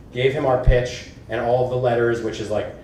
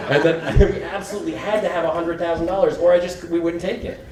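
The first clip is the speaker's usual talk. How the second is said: higher, spoken through laughter; laughing disbelief; 6 semitones higher than usual. Real, not acted. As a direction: laugh at your own nerve while telling it. Laughing at her own nerve.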